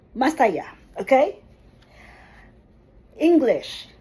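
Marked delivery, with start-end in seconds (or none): 0.1-0.8 "Masaya" said quickly as one word; faster, joined up. Shown as a demonstration. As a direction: say "Masaya" briskly, with no breaks.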